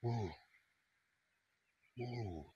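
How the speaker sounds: a real hushed wonder at the plateau; hushed awe. Real, not acted. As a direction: murmur it as if in a holy place.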